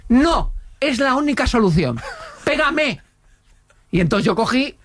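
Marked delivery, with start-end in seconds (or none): 0.1-3.0 desperate insistence. Retold or imitated. Neighbour insists on being slapped.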